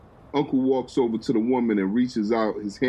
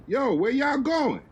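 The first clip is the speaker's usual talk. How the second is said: yelling out. Acted. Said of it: "yells out to the fella".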